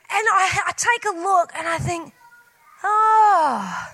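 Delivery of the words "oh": a crying oh, higher and louder; dismayed cry. Retold or imitated. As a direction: wail the oh in dismay. Discovers broken glasses.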